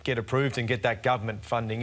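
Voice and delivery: ordinary talk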